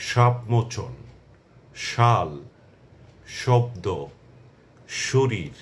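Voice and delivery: each word slow and clear, শ as sh; careful demo pronunciation. Shown as a demonstration. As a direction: spell out the শ as English sh, word by word. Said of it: "এসএইচ এর মতো উচ্চারণ হচ্ছে".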